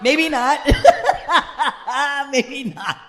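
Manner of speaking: real high, loud burst of laughter; helpless, high-pitched cackling laughter; cracking up. Real, not acted. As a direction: laugh hard right through the words. Cracks up at an aside.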